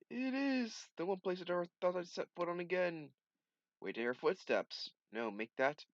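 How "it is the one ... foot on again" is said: excited. Acted. Voicing comic character's joy.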